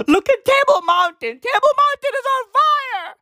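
high, loud alarmed cry; mock alarm. Mock onlooker shouting about Table Mountain, high and loud. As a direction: cry out as if watching the mountain burn.

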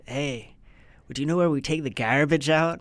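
imitated Nova Scotian with hard, fronted "ar"; lively impression of a Nova Scotia dude; Nova Scotia accent. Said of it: "He was from Nova Scotia".

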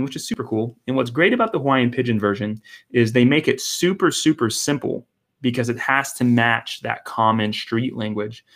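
usual speaking voice

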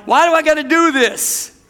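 whiny complaint. Retold or imitated. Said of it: "I'm like".

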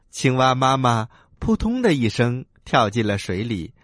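ordinary delivery, nothing marked

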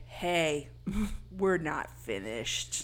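low, soft, slow threat; menacing killer voice. Acted. Killer calling shocked Casey back to the game, low slow menace. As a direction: draw it out low and creepy.

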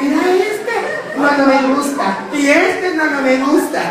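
high mocking gossip voice, choosy and dismissive; picky comadre. Comadre scrolling Facebook, rejecting each man, high and mocking. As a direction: play the fussy comadre turning down every man.

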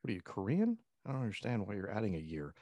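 ordinary talk

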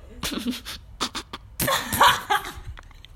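high breathy laughing fit; burst of laughter. Real, not acted. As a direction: laugh hard, almost spitting water. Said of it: "[name] casi escupe el agua".

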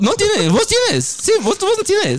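squeaky high mock tone; high playful squeal; high teasing voice. Real, not acted. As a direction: pitch it way up, teasing. Teasing about hair, high voice.

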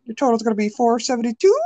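high, quick cashier sing-song, lifting at the end; mock a princess-sweet cashier reading the total.

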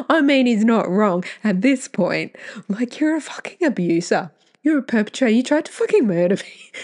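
real indignant outburst at her abuser; laughing fury. Real, not acted. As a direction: let the laugh turn into real anger.

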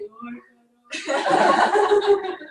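loud laughing hello; laughing greeting. Retold or imitated. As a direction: greet her while cracking up. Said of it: "todo mundo fala assim".

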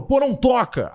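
high, loud mock complaint; retold protest. Host quotes an audio message objecting to playback, mock outcry. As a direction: blurt it out high, imitating his objection. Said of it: "ele tinha mandado um áudio e falou".

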